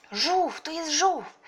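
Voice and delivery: high, loud exclamation; excited cry. A narrator's reading. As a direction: cry out at a sudden discovery. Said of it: "chciała krzyknąć Pola". (podekscytowany, wysoki okrzyk)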